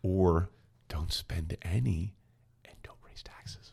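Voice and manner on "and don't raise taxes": hushed mock-secret whisper. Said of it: "I'm gonna whisper into the mic".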